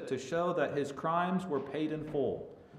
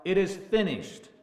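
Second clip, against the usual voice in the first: raised, emphatic retelling of a cry; higher and louder, quoting a triumphant declaration; retold cry; 5 semitones higher, 5 dB louder than usual. Preacher quotes Jesus on the cross, crying out. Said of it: "it says that he cried out".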